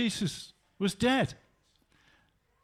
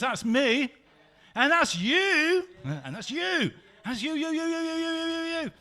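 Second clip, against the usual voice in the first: playful; 8 semitones higher than usual. Real, not acted. Pointing at congregation.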